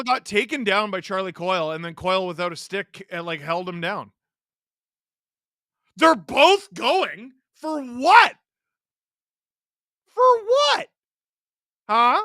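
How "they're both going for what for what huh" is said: loud, high incredulous questioning; indignant protest. Real, not acted. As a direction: demand an answer from the ref, voice jumping up. Protesting a referee's penalty call live.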